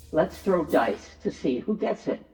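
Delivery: ordinary talk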